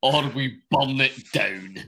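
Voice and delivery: slow, hammed-up battle cry; mock-dramatic proclamation. Host teasing fans who stake all on week one, mock-dramatic proclamation. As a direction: declare it like a do-or-die vow, in jest.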